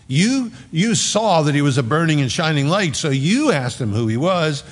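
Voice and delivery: a pastor voicing Jesus to his listeners; fervent preaching. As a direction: plead it out from the pulpit.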